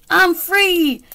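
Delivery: high loud happy cry; joyful. Acted. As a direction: shout out your freedom. Pig released.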